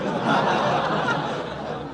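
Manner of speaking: high breathy burst of laughter; big airy chuckle; hearty laugh. Acted. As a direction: laugh at your own joke. [name] laughing at own joke.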